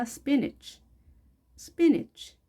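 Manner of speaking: "spinach" with a short i in "-ach"; high-pitched, clipped "SPIN-ich" for "spinach". Shown as a demonstration. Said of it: "짧게 발음하시면 되겠습니다". ("spinach" 끝을 '이치'로 짧게)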